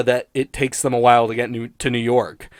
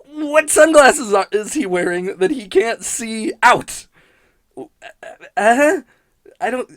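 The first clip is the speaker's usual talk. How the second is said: rising incredulous rant; exasperated disbelief; 8 semitones higher, 5 dB louder than usual. Real, not acted. Reviewer baffled by a Simpsons gag, high incredulous voice.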